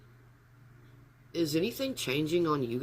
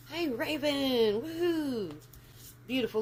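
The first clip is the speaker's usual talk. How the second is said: high, slow and excited, much higher; excited greeting; 12 semitones higher than usual. Real, not acted. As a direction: cheer a viewer's name in delight. Greeting a viewer in chat.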